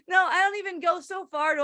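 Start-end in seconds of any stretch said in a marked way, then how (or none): none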